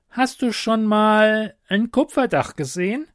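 high-pitched kobold hinting at an explanation; kobold, evasive. Acted. Kobold explains himself to Ute. (hoher Kobold, ausweichend)

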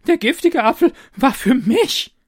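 high, loud, laughing discovery; excited cry. Acted. Taster realises the apple was for him, laughing excitedly. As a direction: burst out with the realisation. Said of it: "rief er".

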